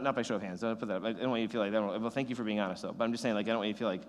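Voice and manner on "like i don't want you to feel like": ordinary talk, nothing marked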